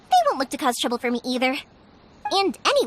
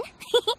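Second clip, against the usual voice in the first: laugh; 7 semitones higher than usual. Real, not acted. Teasing the travelers.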